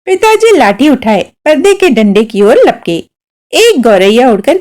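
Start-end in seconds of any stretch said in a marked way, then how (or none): none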